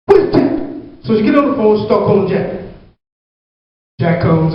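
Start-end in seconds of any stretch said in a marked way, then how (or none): 0.1-0.5 demanding mom. Retold or imitated. Mom demands other man.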